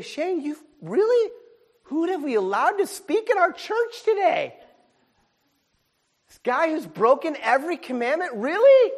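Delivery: high, loud incredulous protest; hammed-up disbelief; mock outrage. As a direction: play the shocked churchgoer. Mock congregant objecting.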